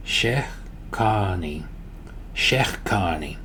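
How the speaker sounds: Shekani drawn out, gap after Shekh; slow practice model. Shown as a demonstration. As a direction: stretch Shekani slowly for learners to copy. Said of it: "practice breaking this down".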